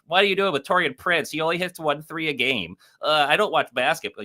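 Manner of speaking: high-pitched mock heckler; mock complaint, pitched well above normal; mocking commenter voice. Mocking commenters who challenged his pick.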